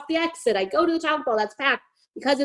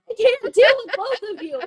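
exasperated; 11 semitones higher, 4 dB louder than usual. Retold or imitated. Overwhelmed by two drunks.

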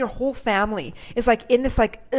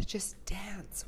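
whisper; 12 dB quieter than usual. Acted. Whispered urging.

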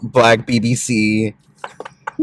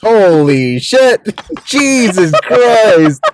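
loud, high, drawn-out exclamation; real shocked outburst; 11 semitones higher, 6 dB louder, much slower than usual. Real, not acted. Host reacting to a search result, loud astonishment. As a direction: cry out slowly in amused shock.

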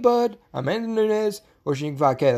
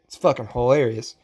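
ordinary talk

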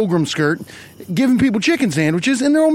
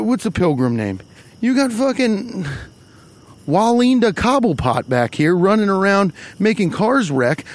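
usual speaking voice

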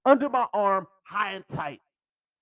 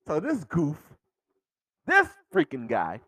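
ordinary talk

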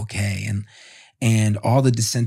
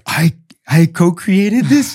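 high, loud and slow, stunned with wonder; awed exclamation; 9 semitones higher, 9 dB louder than usual. Retold or imitated. New dad marvelling at his baby, high loud awe. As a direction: marvel at it like it just hit you. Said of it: "then you're like".

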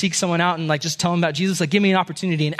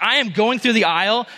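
ordinary delivery

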